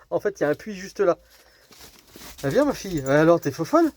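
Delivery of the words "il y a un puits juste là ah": ordinary delivery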